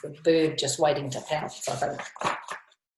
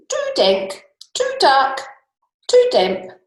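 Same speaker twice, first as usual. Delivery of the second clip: high squeaking little mouse, louder; squeaky mouse; 7 semitones higher, 6 dB louder than usual. Acted. Mouse complains about the cave in a high squeak. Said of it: "Mouse squeaks".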